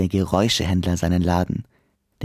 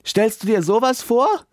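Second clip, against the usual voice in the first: high, louder call from the storeroom; 12 semitones higher, 4 dB louder than usual. A narrator's reading. Noise dealer calls out to the ghost from the storeroom. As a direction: call out over the wind. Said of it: "ruft er".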